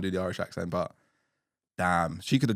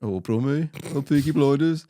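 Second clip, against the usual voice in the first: mock Birmingham accent; 5 semitones higher, 6 dB louder than usual.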